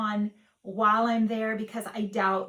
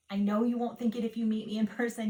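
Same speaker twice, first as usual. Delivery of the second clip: usual speaking voice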